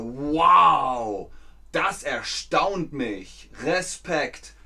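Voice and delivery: wow at the pyramids, slow and impressed; slow mock tourist awe; amazed. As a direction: gaze up at the pyramids and marvel. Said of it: "dann sagt ihr".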